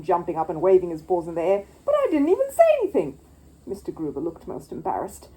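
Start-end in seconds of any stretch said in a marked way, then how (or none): 1.8-3.2 high, loud indignant bear; squeaky outraged little bear; Paddington exclaiming. Acted. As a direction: protest loudly in a high voice. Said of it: "exclaimed Paddington".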